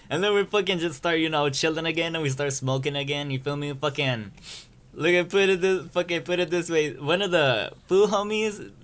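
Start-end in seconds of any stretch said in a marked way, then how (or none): none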